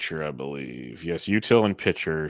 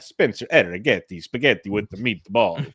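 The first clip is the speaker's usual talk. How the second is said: reprise a mock Italian accent; hammed-up, bouncy Italian accent; playful, badly faked Italian accent to cap a list. Reprising the bad Italian accent bit.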